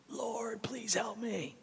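breathy whispered plea; desperate whisper. Retold or imitated. Preacher imitating Jesus whispering a desperate prayer. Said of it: "he's just like".

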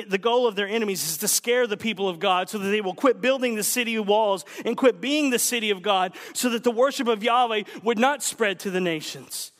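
ordinary delivery

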